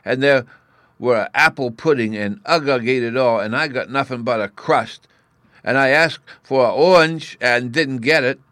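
put-on high, loud little-boy grievance; childish complaint, piling up the wrongs; tearful, aggrieved Bruno. A narrator's reading. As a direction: pipe up like a hard-done-by small boy.

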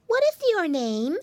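high squeaky little pig; a small piglet asking; piggy boy voice. A narrator's reading. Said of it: "he asked the lady".

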